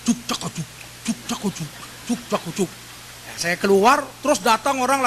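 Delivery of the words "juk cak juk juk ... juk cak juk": mimicking a disco beat, softer. Preacher imitating the music heard from the basement. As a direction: juk cak juk like thumping club music. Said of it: "ada bunyi musik".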